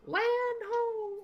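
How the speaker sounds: high distant sailor's call; shouting from crow's nest. Acted. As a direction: call out from up the mast. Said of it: "Wasn't that beautiful? ... I tried really hard on that voice".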